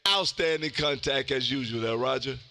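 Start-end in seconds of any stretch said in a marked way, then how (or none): none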